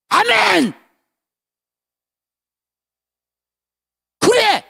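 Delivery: loud, high joyful cry; shouted amen. Acted. Pastor reliving his moment of faith, loud high shout. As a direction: shout amen with all you have.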